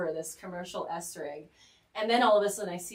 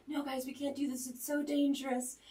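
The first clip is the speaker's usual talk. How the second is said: higher-pitched mock pleading; retold worried protest; 7 semitones higher than usual. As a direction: plead with them, voice raised in pitch only. Retelling her protest at the plan.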